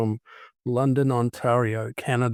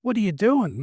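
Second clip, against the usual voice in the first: startled question; 11 semitones higher than usual. Retold or imitated. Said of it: "And he's like".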